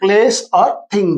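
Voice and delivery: each word drawn out for note-taking; slow, deliberate teacher dictation. Shown as a demonstration. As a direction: dictate it slowly so students can write. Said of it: "dictating the definition slowly".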